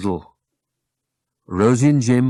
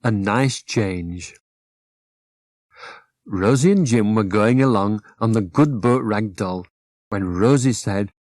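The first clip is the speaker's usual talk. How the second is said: plain reading voice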